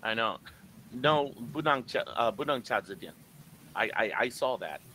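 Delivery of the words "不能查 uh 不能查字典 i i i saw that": usual speaking voice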